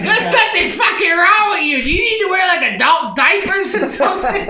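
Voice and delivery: high, loud disgusted shriek; disgusted wife. Retold or imitated. Wife disgusted at husband's mess, high and loud. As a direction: recoil and scold like a grossed-out wife. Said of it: "she's like".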